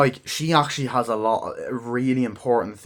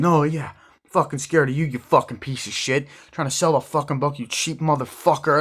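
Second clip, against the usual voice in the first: loud, higher cursing tirade; angry swearing; 5 semitones higher, 4 dB louder than usual. Retold or imitated. Donnie swearing at the motivational speaker, loud and angry. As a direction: tear into him.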